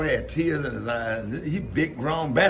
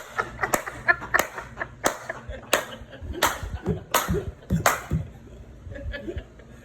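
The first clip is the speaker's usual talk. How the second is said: long wheezing laugh; real breathless laughing fit; helpless laughter; 10 semitones higher than usual. Real, not acted. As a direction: laugh till you cry. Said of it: "Mike Epps had me crying".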